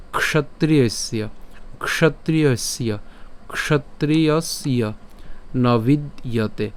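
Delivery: ক্ষত্রিয়স্য repeated slowly, kshotriyasya; slow drilled ক্ষত্রিয়স্য then নবিদ্যতে; slow repeated demo. Shown as a demonstration. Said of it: "একসাথে বলবো নবিদ্যতে".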